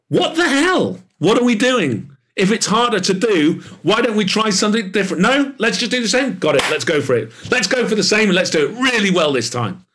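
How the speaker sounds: high, incredulous mock rant; mocking exasperation. Mocking industry thinking, quoted voice.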